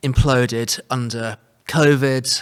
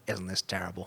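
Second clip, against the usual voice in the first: snooty East Coast American; 5 semitones lower, 11 dB quieter than usual. Retold or imitated. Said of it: "if I was some snooty, uh, East Coast American".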